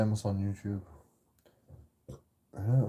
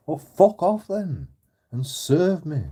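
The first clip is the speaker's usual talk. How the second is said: angry retort; 7 semitones higher, 4 dB louder, slower than usual. Retold or imitated. Retorting at store worker.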